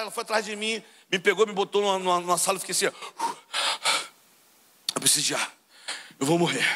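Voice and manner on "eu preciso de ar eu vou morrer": out of breath. Retold or imitated. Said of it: "Sem ar".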